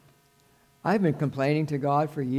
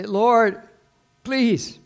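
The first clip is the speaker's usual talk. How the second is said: raised, higher pleading cry to God; crying out in prayer; 6 semitones higher, 8 dB louder than usual. Acted. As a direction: call out to the Lord, imploring. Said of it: "You, you can say, ... cry out to him".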